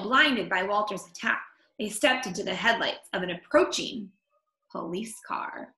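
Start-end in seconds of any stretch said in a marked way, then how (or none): none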